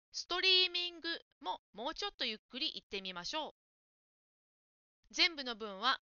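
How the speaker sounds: normal talking voice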